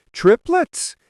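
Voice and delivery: high rising astonished question. A narrator's reading. Wedding guest hears about triplets, astonished. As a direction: squeak it out in surprise.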